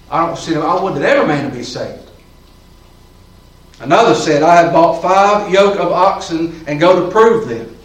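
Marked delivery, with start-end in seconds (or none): 3.8-7.7 solemn scripture reading. Acted. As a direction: lean into each word of scripture.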